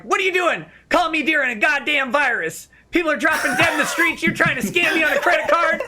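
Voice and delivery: ranting at the scammer, half laughing; mock outrage. Retold yelling at scam caller.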